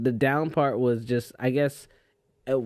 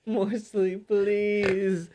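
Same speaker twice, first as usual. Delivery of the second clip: higher, whiny half-asleep begging; sleepy plea; 7 semitones higher, much slower than usual. Retold or imitated. Friend replaying his own sleepy begging at the door, whiny. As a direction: beg for more sleep like a tired kid.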